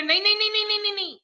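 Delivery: high, rapid-fire string of protests; खुरापाती की आपत्ति. Acted. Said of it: "अब जैसा कोई खुरापाती हो". (खुरापाती बनकर ऊँची आवाज़ में टोकना)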